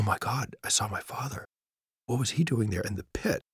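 breathy half-whisper, soft and stunned; a soft, awed whisper of remembering; hushed stunned whisper. Retold or imitated. Waking in ICU, recalling father, hushed whisper.

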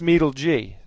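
Meedle G said as "MEE-dul JEE"; alternative pronunciation. Shown as a demonstration. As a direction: split the name as Meedle, then the letter G. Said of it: "I think it's pronounced".

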